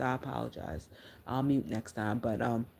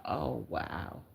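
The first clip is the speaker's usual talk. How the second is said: quiet stunned wow; 4 semitones lower, 8 dB quieter than usual. Retold or imitated. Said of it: "and I said".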